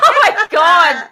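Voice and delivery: laughing through the words, slowly. Real, not acted. Laughing at the word.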